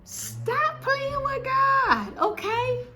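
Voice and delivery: very high, slow, drawn-out cry of praise; emotional preaching cry. Real, not acted. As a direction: let it soar, overcome with joy and awe. Overcome testifying about getting keys.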